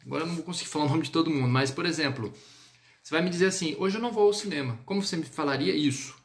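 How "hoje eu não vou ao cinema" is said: quick, higher "Hoje eu não vou ao cinema"; natural quick tune, "cinema" dropping; everyday Portuguese intonation. Shown as a demonstration. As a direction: toss off "Hoje eu não vou ao cinema" briskly, falling at the end. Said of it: "Qual a entonação que você me diria".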